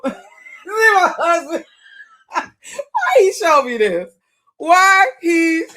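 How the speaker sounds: loud, high words between real laughs; cracking up. Real, not acted. Reacting to what husband showed them, cracking up. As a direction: blurt it out through laughter.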